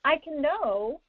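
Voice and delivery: normal talking voice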